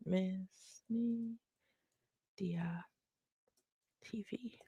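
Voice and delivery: soft, slow, voiceless murmur to herself; whisper while typing. Real, not acted. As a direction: mouth it under your breath as you type. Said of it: "Let me type it".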